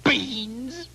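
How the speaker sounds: very high, slow wailing cry; high sobbing shout of a distraught mother. Acted. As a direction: she despairs at her fool of a son. Mother wails at the foolish trade.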